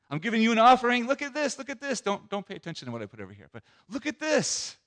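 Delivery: high, loud, showing off his offering; a proud brother shouting look at this; boastful Cain. Acted.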